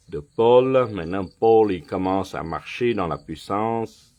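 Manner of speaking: usual speaking voice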